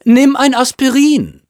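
loud, very high-pitched call; calling after someone. A narrator's reading. Mother calling after her son as he leaves, shouted. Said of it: "rief ihn Misses Chasen hinterher".

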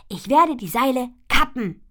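laut und hoch, fest entschlossen. Acted. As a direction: mit Nachdruck hinausrufen. Ponyfee entschlossen, aufgebracht. (laute, entschlossene Mädchenstimme)

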